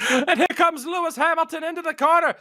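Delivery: high, loud commentator voice; mock race commentator. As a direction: hype up the corner call.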